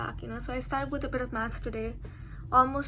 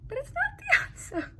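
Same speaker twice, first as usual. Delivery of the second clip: laughing. Real, not acted. Laughs at wrong answer.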